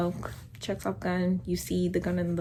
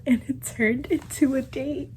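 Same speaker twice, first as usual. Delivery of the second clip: laughing; 6 semitones higher than usual. Real, not acted. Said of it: "let me calm myself down".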